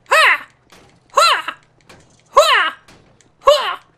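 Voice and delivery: loud, short bursts; grunted "ha". Pretend. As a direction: shout it with each blow. Hitting the car.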